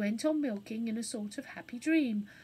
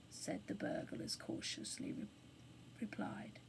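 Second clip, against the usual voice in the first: hushed; 11 dB quieter than usual. A narrator's reading. Narration drops to whisper.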